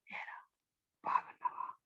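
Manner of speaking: hushed, voiceless whisper. Shown as a demonstration. Speech trainer demonstrates a greeting to someone close by, whispered. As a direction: whisper it to someone right beside you. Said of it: "గుసగుసలు".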